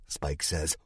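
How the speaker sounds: ordinary delivery, nothing marked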